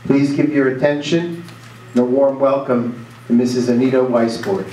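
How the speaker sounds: ordinary talk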